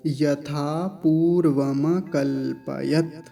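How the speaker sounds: यथा पूर्वम कल्पयत slowly, syllable by syllable; slow careful recitation. Shown as a demonstration. Teacher reciting the full phrase for students. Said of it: "पूरा शब्द का उच्चारण".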